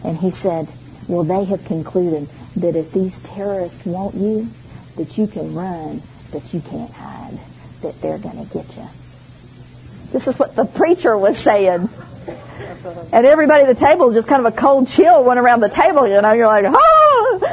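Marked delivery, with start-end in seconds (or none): 1.1-8.9 low, softer doom-laden preacher sinking to a whisper; scary preacher voice. Retold or imitated. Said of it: "in his best scary preacher's voice". 16.7-17.4 high startled oh; mock fright; startled gasp. Cold chill at the table.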